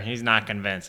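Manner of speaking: ordinary delivery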